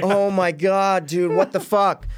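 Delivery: slow, high, drawn-out exasperation; exasperated. Retold or imitated. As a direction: stretch it out in disbelief.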